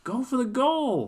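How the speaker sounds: loud, high, slow shout. Acted. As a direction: yell it to push him on. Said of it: "Frank shouted".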